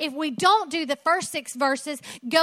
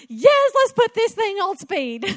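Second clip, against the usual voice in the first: loud, high, hammed-up hurry; mock the urge to rush through; eager mock voice; 7 semitones higher, 7 dB louder than usual.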